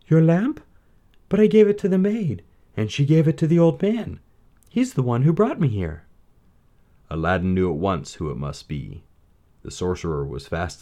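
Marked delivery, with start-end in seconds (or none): 0.1-6.0 much higher surprised princess; a startled young princess. A narrator's reading. Princess answers.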